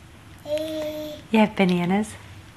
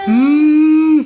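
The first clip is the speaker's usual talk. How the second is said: a big, long, louder moo; hammed-up lowing moo; loud cow moo; 2 semitones higher, 6 dB louder than usual. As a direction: bellow it like a cow for the toddler. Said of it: "What's a cow say?".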